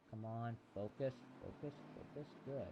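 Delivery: very soft, under the breath; hushed private pep talk; self-coaxing mutter. Real, not acted. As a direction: quietly urge yourself to concentrate. Talking to himself, refocusing.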